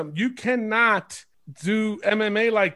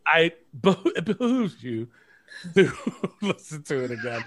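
laughing; 3 semitones lower than usual. Real, not acted. Podcaster urges co-hosts to listen again, laughing. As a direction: laugh through the words while urging them on. Cracking up over his own phrase.

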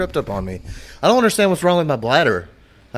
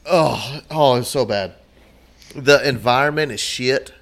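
ordinary delivery

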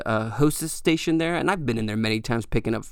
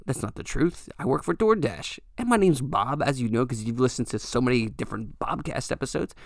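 ordinary talk

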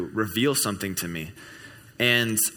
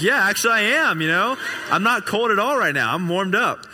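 high-pitched, louder, delighted retelling; excited acted reply; 10 semitones higher, 4 dB louder than usual. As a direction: act out the caller's happy amazement. Retold caller amazed he is warm.

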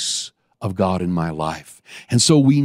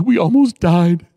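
retold scared passenger; 7 semitones higher, 6 dB louder than usual. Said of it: "he goes".

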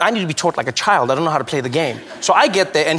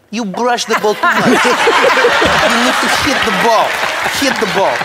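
laughing, emphatic coach; 4 semitones higher, 4 dB louder than usual. Retold or imitated. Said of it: "She's like".

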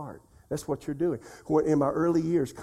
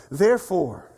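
"therefore" punched louder, falling at the end; stress on therefore; 3 semitones higher, 6 dB louder than usual. Shown as a demonstration. Preacher showing how to emphasize one word, "therefore" punched. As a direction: hit the word "therefore" hard. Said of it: "you can emphasize the word".

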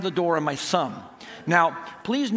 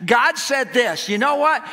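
raised, emphatic sermon delivery; preaching; 5 semitones higher, 3 dB louder than usual. Real, not acted. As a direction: drive the point home to a congregation. Preaches after telling the story.

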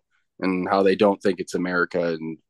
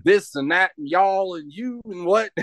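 higher-pitched imitation of Southern yelling; garbled Southern jabber, pitched up in mimicry; mock Southern accent; 8 semitones higher than usual. Storyteller imitating women arguing with a store clerk, mock Southern accent. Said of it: "they're yelling so loud and that Southern accent".

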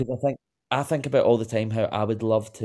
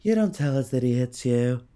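higher, accusing Camille Grammer voice; Camille Grammer impression; 5 semitones higher than usual. Host performs her favourite Camille Grammer quote, accusing. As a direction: point the finger like Camille at the reunion. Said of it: "one of the easiest Camille Grammars to do".

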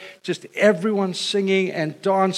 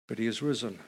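softer, lower: but he is risen; quiet refrain; 5 semitones lower, 10 dB quieter, faster than usual. Acted. Preacher answering each suffering of Christ, soft and low. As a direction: let the answer fall soft and sure.

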